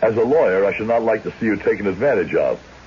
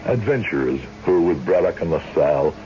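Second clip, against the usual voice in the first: low, measured storyteller voice; story narration; 3 semitones lower than usual. A narrator's reading. Old judge telling his tale in calm narration. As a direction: recall the past gravely for the listener.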